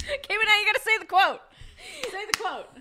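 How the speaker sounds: high laughing urging; giggling through words. Real, not acted.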